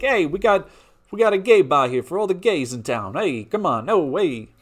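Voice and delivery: loud, high, hammed-up Italian-American mafia accent; mobster Italian accent. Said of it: "I just like picturing, homophobic Italians".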